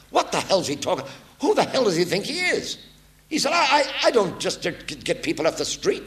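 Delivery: imitating a furious producer, high and loud; mock outrage, voice climbing on "I, I, I". Producer fuming at the stranger sent instead, mock furious. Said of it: "the producer was furious".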